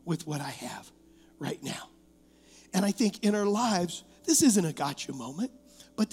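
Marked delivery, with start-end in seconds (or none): 0.0-1.9 much softer, lower reflective question; soft inward question; quiet. Shown as a demonstration. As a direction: drop it to a near hush to make them think. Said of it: "What am I doing".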